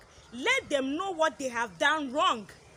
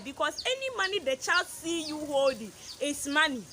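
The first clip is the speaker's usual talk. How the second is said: usual speaking voice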